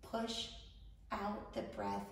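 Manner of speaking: unhurried instructions, leaving room to breathe; guided meditation. Acted. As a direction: guide a panicked listener calmly through each breath.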